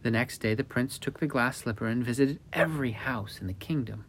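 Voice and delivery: ordinary delivery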